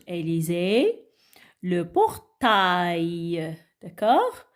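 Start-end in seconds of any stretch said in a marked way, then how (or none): 1.6-3.6 slow, stretched PORTAIL; model reading. Shown as a demonstration. Teacher reads the word for children to follow, slowly. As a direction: draw out PORTAIL with a long "ta-y" ending. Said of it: "Lisons avec moi ces mots".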